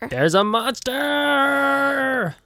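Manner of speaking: very high, long drawn-out mock cry; hammed-up monster announcement. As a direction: stretch it out high like a campy reveal. Playfully announces the monster's entrance.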